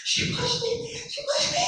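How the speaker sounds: put-on high, frantic little girl's cry; panicked child telling her mother; breathless child. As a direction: gasp it out like a scared nine-year-old. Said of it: "I'm breathless".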